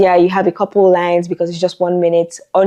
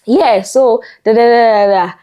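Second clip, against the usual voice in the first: mock stutter; 5 semitones higher, 5 dB louder than usual. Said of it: "I'll just be like".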